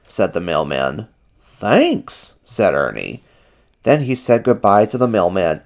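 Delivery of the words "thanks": high, chirpy character voice; high-pitched puppet-like thanks; Ernie's voice. A narrator's reading.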